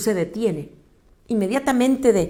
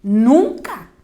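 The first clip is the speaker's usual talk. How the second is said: emphatic refusal; 3 semitones higher, 6 dB louder than usual. Acted. Pedro se niega.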